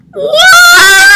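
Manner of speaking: very high, loud cat shriek; a cat crying out in disbelief; shocked cry. Acted. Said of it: "cried Mercury".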